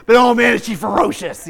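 loud mock-fierce exclamation; half-joking loud exclaim about the dog; playful shout. Real, not acted. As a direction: belt it out in fun.

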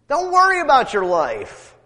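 high, loud repeat of the line; emphatic repeat. Acted. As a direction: drive the point home again. Repeated line, still raised.

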